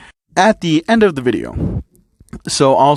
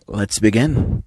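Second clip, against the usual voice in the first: usual speaking voice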